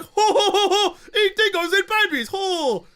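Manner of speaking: loud, high mock guffawing; mocking crowd. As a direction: imitate everyone hooting at a cruel joke. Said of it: "And everyone's like".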